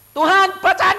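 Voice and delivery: high, loud plea to God; loud prayer. Retold or imitated. Preacher kneeling with the telegram, crying out to God loudly. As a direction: cry out and demand he read it. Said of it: "Saya begitu niru".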